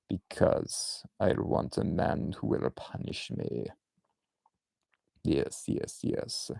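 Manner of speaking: slow, soft whispered Russian purr; hammed-up whispering villainess; Russian accent whisper. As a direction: breathe it in a hushed Russian accent. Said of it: "hail Hydra like this".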